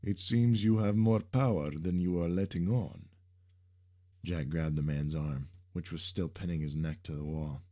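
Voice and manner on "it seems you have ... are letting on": villain lightly intrigued, leaning in; a touch of interest as he sizes him up. A narrator's reading. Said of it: "said with an intrigued tone".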